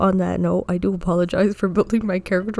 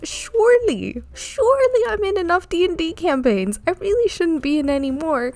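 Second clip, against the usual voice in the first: high, put-on reluctant whine; self-mocking high protest; 9 semitones higher than usual. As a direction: play at refusing, knowing you'll cave. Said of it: "even though I said".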